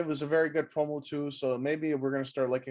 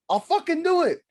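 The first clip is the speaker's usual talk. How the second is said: high, loud mock threat; hammed-up yell; 7 semitones higher, 5 dB louder than usual. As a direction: shout it as a playful bluff. Repeats threat bit.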